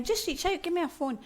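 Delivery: high, sing-song mocking quote; mocking cliché. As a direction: parrot the cliché with a high lilt. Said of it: "we say it all the time".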